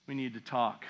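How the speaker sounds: hushed low warning; stern dad. Retold or imitated. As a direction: drop it low like a father about to punish. Dad's stern confrontation.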